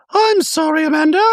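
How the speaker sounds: high, prim voice for Dorit; Dorit impression. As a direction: play Dorit sweetly apologetic in a high voice. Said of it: "And Dorit goes".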